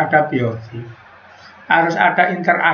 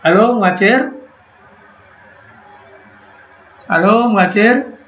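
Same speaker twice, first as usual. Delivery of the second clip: raised, questioning hail; calling out; 4 semitones higher than usual. Real, not acted. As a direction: call for a student with a rising lilt. Repeatedly hailing a silent student. (memanggil dengan nada naik)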